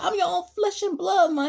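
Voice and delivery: high, loud, hurt plea to his mother; retold pleading. Son pleading with his mother, high and loud. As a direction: cry out that he's her own son.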